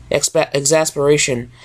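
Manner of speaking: plain reading voice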